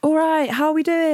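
high, stretched MC call to the crowd; MC crowd greeting. Retold or imitated. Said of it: "You, um, do, like".

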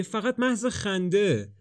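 slow, high coaxing repeat of فقط محض خنده; slow pleading. A narrator's reading.

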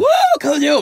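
high, loud whooping shout; hyped cheer. Retold or imitated. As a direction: whoop like a wasted superfan.

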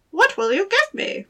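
high squeaky little goblin voice; gravelly sly hobgoblin. Acted. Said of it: "said the hobgoblin".

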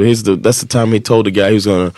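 normal talking voice

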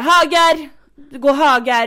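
loud, high shouted directions; shouting. Retold or imitated. Girlfriend yelling directions to boyfriend far away, retold shout. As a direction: yell it to someone far ahead. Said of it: "jag får typ skrika så här".

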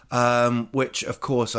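normal talking voice

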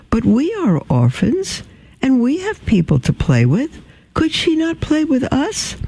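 put-on high, earnest little girl pleading. Orphan Mimosa asking the nun if the princess can play, high childlike. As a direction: lift into a small child's eager plea.

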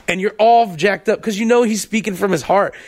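normal talking voice

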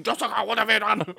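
high-pitched, hammed-up reproach; mock-scolding Reverend Mother. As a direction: act out an appalled elder scolding Jessica. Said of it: "she's like".

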